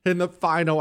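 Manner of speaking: punchy talk-radio rundown; radio show intro. Real, not acted. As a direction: sell what's coming up over the music bed. Radio show opening over music.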